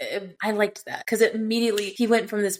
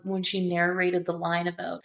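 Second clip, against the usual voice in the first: ordinary delivery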